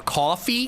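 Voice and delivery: "coffee" drawn out with an AW vowel, rising; testing the pronunciation. Shown as a demonstration. Streamer testing how to say it, "coffee" as questioning KAW-fee. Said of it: "how are you supposed to say it".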